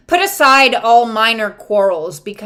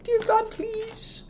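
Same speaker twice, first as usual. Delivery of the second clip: high, soft whimpering plea; high, soft, slow begging voice; pleading; 10 semitones higher, 10 dB quieter, slower than usual. Retold or imitated. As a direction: beg weakly like a needy child.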